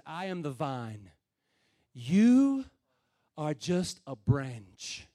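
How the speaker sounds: "You" punched loud after a pause, then "are just a branch" slow; stress on YOU. Shown as a demonstration. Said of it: "I don't know if he put the emphasis on it that way".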